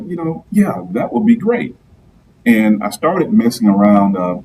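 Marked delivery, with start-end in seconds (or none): 0.0-1.8 higher, lightly acted eager yes; mock eager reply. Guest retelling his answer to a friend, mildly mock eager. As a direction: play up your own keen younger self. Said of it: "she was like".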